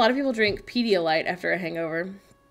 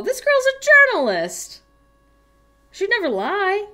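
mock indignation; 10 semitones higher, 6 dB louder than usual. Mock defence of journalist.

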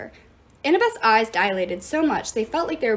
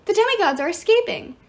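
high, loud alarm; alarmed yell; 9 semitones higher, 5 dB louder than usual. A narrator's reading. Iris warning that demigods escape, alarmed yell. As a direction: raise the alarm urgently. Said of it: "Iris yelled".

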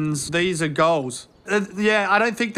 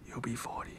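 whispered ominous warning; 8 semitones lower, 18 dB quieter, faster than usual. Acted. Mate whispers her grim future age. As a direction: breathe it like a grim prophecy.

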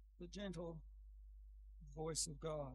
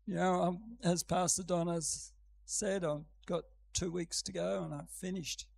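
usual speaking voice

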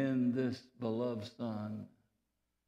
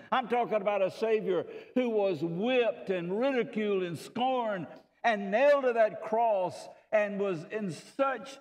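louder, rising preacher's cadence building on each phrase; full-force preaching; 6 semitones higher, 4 dB louder than usual. Acted. Preacher proclaiming the Savior to the church, louder and higher. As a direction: preach it out, lifting the congregation.

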